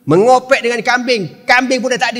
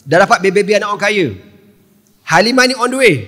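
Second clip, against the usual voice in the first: ordinary talk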